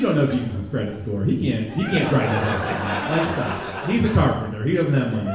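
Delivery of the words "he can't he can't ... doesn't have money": ordinary talk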